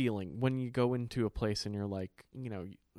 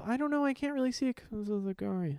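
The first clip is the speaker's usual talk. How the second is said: put-on much higher little-kid whine; mock small child; 11 semitones higher than usual. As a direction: sound like a put-out little girl. Imitating small child answering.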